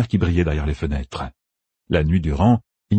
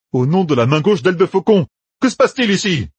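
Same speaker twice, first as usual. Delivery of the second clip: much higher and louder angry demand; 12 semitones higher, 5 dB louder than usual. A narrator's reading. Matt confronts the innkeeper, loud and angry. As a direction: demand answers, rattled and angry. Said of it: "grogna Matt".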